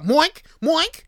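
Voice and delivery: loud, high yelped "Moink!" twice; shouted moink noise. Retold or imitated. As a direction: bark the made-up noise like a war cry. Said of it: "and you're like".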